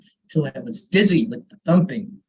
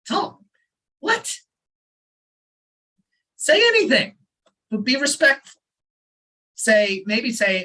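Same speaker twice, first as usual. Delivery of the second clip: plain reading voice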